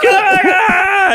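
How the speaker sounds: high-pitched mock war cry; imitated pursuer's scream. Store owner chasing a thief, imitated high yell. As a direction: holler like someone giving chase. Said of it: "The Korean guy going".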